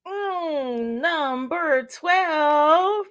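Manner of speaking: high, very slow bingo call; bingo caller. Acted. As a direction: sing out the number like a game host. Bingo number reveal.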